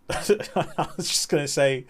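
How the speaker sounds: slowed words broken up by giggles; laughing. Real, not acted. Streamer cracking up mid-remark to the chat, laughing. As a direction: try to speak through a laugh.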